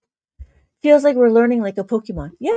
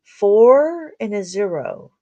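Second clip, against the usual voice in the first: slow teaching; 5 dB louder, much slower than usual. Shown as a demonstration. Teacher spelling out the digits.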